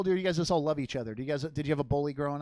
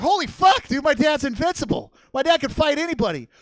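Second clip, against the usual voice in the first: higher, louder awe; amazed kid; 10 semitones higher, 8 dB louder than usual. Retold or imitated. Boy bragging to his friends about his dad, excited awe. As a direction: gush like a thrilled kid. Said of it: "And I was like".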